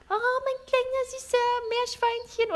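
put-on high, cooing baby talk; baby talk to a pet. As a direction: coo at a guinea pig like a baby. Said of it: "in Babysprache sprechen".

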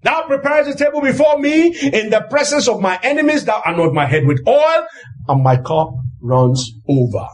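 usual speaking voice

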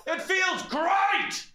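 ecstatic high shout. Acted. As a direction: yell like jumping into icy sea. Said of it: "Sorry, I'm shouting too much".

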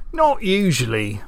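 lightly voiced, pondering farmer; thoughtful old farmer. A narrator's reading. Farmer answering about the castle, thoughtful. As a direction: consider it as an old rustic. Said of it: "said with a thoughtful scratch on his chin".

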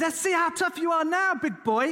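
high, loud taunting threat; a hammed-up gangster sneer; mock gunman threat. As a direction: menace him with the gun. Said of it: "He says".

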